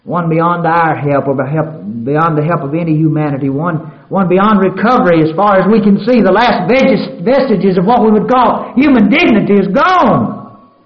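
loud, high, ringing sermon delivery; full-force preaching. Acted. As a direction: lift the congregation with the cry. Preacher climbs again.

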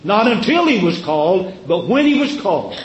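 until rising, then when landed; contrastive stress. Shown as a demonstration. Preacher contrasting the two readings for the church. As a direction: set Not until he was called against but when he was called. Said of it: "See how he stresses that?".

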